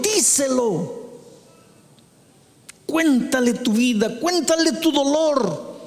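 high, urgent pulpit exhortation; high-pitched pleading sermon delivery; fervent preaching. Acted. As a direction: urge the congregation with fervor. Urges congregation to cry out.

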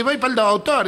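high-pitched acted old lady; old woman's reply. Old woman answers the man.

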